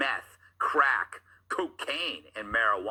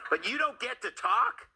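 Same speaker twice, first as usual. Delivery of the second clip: much higher, incredulous question; mock disbelief; 10 semitones higher than usual. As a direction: sound baffled by the absurdity. Host mocks her line incredulously.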